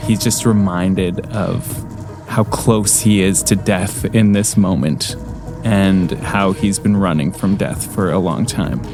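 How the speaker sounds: ordinary delivery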